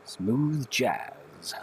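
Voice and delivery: smooth late-night DJ; radio voice. Pretend. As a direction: croon it like a radio host. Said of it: "You have a radio voice".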